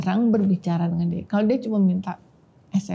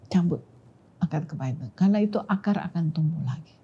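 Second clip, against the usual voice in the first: low, hushed whisper fading out; whispered voice of God; 4 semitones lower, 5 dB quieter than usual. Retold or imitated. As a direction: murmur it softly like an inner voice. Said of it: "Tuhan bilang". (bisikan Tuhan yang lirih)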